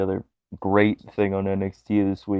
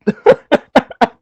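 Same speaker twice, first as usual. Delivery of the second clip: high laugh; 10 dB louder than usual. Real, not acted. Laughing at wrestling story.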